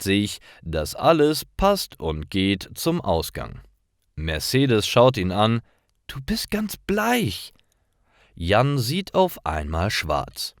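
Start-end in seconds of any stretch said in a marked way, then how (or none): 6.1-7.5 high, lightly voiced concerned friend; worried friend. Friend tells dizzy Jan he looks pale, high and mildly worried. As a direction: lift the voice up high, a mild note of worry.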